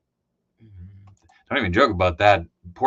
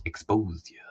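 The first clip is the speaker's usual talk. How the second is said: unvoiced conspiratorial whisper; hushed aside to the chat; 2 semitones lower than usual. Real, not acted. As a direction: whisper it so nobody overhears. Whispers so as not to expose a friend.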